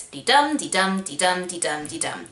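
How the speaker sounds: weak then strong beat, dee-DUM; da-dum rhythm. Shown as a demonstration. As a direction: tap out the iambic beat. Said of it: "it goes".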